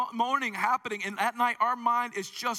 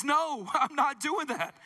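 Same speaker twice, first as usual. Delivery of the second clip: slow, higher amused refusal; God's reply; 6 semitones higher, much slower than usual. Retold or imitated. God answering the six-pack prayer, amused refusal. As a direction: turn it down with a laugh. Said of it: "And he says".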